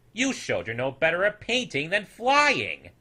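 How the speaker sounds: mock his effort; sarcastic snoodles. A narrator's reading. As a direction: townsfolk snoodles mocking his painting. Snoodles mocking.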